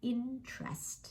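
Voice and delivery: IN-trest, high start, soft second syllable; two syllables. Shown as a demonstration. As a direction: say "interest" as just two beats, IN-trest. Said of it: "เหมือนเป็นสองพยางค์". (ออกเสียง interest เป็นสองพยางค์ อิน-เทรสต์)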